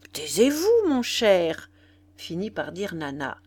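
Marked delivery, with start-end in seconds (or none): 0.1-1.7 higher, louder hushing; mild exasperated hushing. A narrator's reading. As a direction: cut him off, mildly exasperated. Nana hushes the barker.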